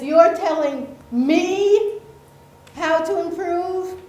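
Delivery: acting out an affronted boss; incredulous boss. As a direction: lean slowly and loudly on the words, voice rising in disbelief. Boss's indignant question in cartoon.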